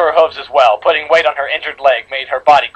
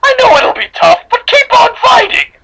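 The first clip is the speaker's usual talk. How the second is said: high, loud sing-song taunt, slowing; twisted cheerful voice; 4 dB louder than usual. Acted. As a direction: cheer her on with sick glee. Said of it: "The twisted words sounded so cheerful".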